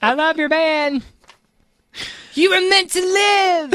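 high, drawn-out put-on voice; mock surfer exchange. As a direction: stretch it out in a lofty surfer voice. Acted meeting with surfer singer.

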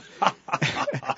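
high burst of laughter; amused, high-pitched laugh. Real, not acted. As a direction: laugh at your own story. Laughs at his own anecdote.

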